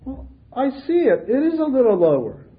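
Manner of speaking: high, careful play-acting; mock solemn elder. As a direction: pretend gravely to fix it. Elder pretends to measure.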